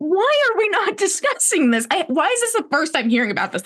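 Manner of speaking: high-pitched outrage. Retold or imitated. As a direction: squeal it in disbelief. Outraged quote about news.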